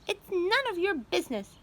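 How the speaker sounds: high, dismissive nag; high snappy aunt. A narrator's reading. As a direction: brush the boy off. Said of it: "Aunt Spiker snapped".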